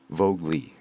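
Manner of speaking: Vogue Lee as VOHG-lee; sounding out a name. Shown as a demonstration. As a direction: say "Vogue Lee" as one name ending in a clear "lee". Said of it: "how do you pronounce your name".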